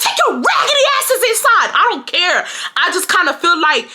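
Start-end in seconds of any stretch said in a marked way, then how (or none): 0.0-1.7 high, heated mock scream; retold going off. Daughter going off on the kids, high retold yell. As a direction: order them off in a raised, high voice. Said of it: "So me, I went off".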